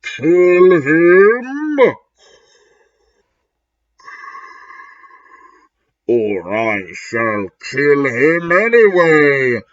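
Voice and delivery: slow threatening command; menacing villain order. Acted. The villain orders Ebony to kill him, menacing. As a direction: lean on the threat.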